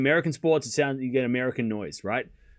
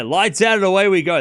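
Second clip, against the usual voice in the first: loud pitched-up race start call; 6 semitones higher, 9 dB louder than usual. Retold or imitated. Streamer imitating the F1 commentator's famous call, loud and high. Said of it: "the guy that says, "Lights out".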